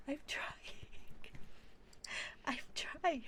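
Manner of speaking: high, loud laughing protest; flustered protest. Real, not acted. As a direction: protest helplessly, pitched well up. Flustered reply to being hurried along.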